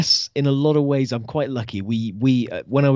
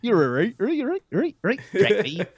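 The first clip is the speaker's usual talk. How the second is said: much higher Cornish granddad voice; 7 semitones higher than usual. Retold or imitated. On the phone with his Cornish granddad, much higher Cornish voice. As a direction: rattle off Cornish yeses like granddad. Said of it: "talking to him on the phone".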